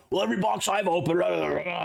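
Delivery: mocking imitation. Streamer imitating box-opening complainers, mocking. Mimicking complainers, ending in blah blah.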